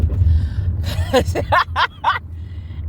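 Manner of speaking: quick wheezy laugh; breathy laugh. Real, not acted. Laughing at her own remark.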